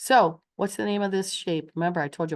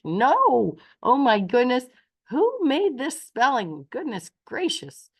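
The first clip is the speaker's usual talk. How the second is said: higher-pitched playful exasperation, opening on a loud "No"; mock outrage; 5 semitones higher, 4 dB louder than usual. Teacher jokes to class about crazy spelling, mock outrage. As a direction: act scandalized for young pupils.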